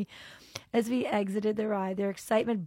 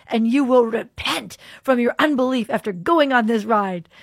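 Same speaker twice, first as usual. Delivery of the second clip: teasing; 4 semitones higher, 9 dB louder than usual. Retold or imitated. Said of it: "with a teasing tone".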